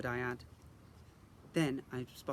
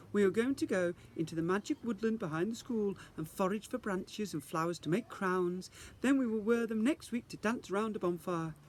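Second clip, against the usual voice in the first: teacher rallying the class; cheerful fairy teacher; 6 semitones higher than usual. Acted. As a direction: announce it with grand enthusiasm. Teacher announces garland lesson.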